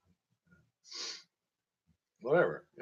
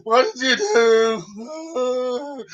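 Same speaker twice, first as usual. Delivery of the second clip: high, loud, drawn-out wail of horror; mock horrified wail; 13 semitones higher, 9 dB louder, much slower than usual. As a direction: play the shocked friend howling at the mess. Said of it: "He's like".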